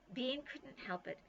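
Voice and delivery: plain reading voice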